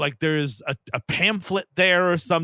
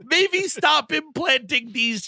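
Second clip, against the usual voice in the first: higher, laughing, slow sarcastic reply; laughing retort; 10 semitones higher, much slower than usual. Retold or imitated. Answering the movie cop, higher and laughing. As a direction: laugh it out slowly at the character. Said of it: "I'm like".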